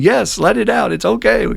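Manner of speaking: acted urging, high and loud; high-pitched, emphatic mock supporter; loud encouragement. Voicing people encouraging a griever.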